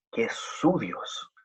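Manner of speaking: "su" held long and slow, the phrase soft; stress on su. Shown as a demonstration. Preacher to congregation, dwelling on "su". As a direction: slowly lean on "su" in "su Dios". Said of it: "no es simplemente Dios en un sentido lejano".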